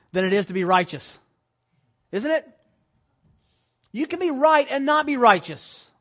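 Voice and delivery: high, loud preacher's push; full-force preaching. Acted. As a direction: drive it home to the congregation.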